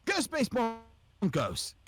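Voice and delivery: high, stretched-out Aussie accent; Australian accent. Shown as a demonstration. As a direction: put on a broad, high Australian drawl. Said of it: "like, an Aussie accent".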